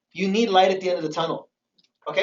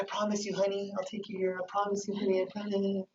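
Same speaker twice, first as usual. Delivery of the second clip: much softer, sweet-talking; soft coaxing promise; 13 dB quieter than usual. Retold or imitated. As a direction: coax her gently, honey-voiced. Imitating partner's empty promises.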